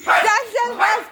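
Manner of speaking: high, urgent calls with a laugh; half-laughing, high-pitched commands; calling off dog. Real, not acted. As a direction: call an overexcited dog away. Calling barking dog away.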